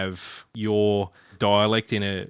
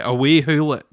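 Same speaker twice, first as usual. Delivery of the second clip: Scottish accent; 3 semitones higher, 4 dB louder than usual. Shown as a demonstration. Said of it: "putting on the Scottish accent".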